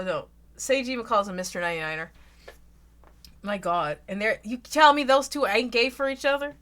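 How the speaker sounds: ordinary delivery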